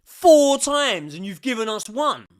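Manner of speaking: loud, slow furious yelling at the ref; outraged fan shouting at the ref; angry shout. Real, not acted. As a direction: bellow it at the referee in disbelief. Yelling at the referee's decision.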